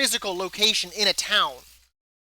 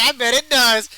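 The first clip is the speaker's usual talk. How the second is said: louder second take, laughing; louder laughing repeat of innuendo; 5 semitones higher, 9 dB louder than usual. Retold or imitated. Repeating the innuendo to her, louder through laughter. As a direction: punch it harder through a laugh. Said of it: "I was like".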